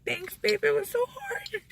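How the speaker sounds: very high, small whiny voice; mock tearful whine. Thanking partner after skipping rice, mock tearful squeak. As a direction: squeak it out like a hammed-up near-sob.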